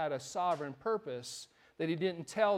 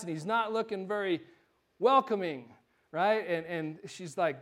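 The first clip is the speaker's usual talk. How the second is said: ordinary talk, nothing marked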